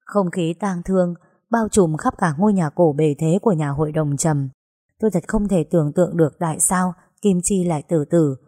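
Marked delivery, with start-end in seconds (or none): none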